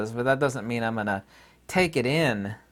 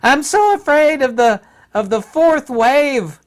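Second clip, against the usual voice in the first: high, loud mock panic, much higher; mocking fearful woman; 14 semitones higher, 10 dB louder than usual. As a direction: ham up a frightened official crying doom. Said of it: "she was fear-mongering".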